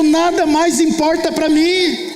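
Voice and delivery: wife declaring. Retold or imitated. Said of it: "Ela falou".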